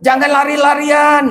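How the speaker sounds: put-on high loud shout at a running child; parent shouting. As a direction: yell it across the yard. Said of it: "Lamun kurang disebutkan".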